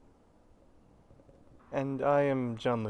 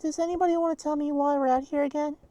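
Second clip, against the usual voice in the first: lightly raised, bright-edged group leader; high character voice; 4 dB louder than usual. Acted. Leader asking the group why they're out here, in a high voice. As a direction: pitch up for the leader of the circle. One player voicing many characters.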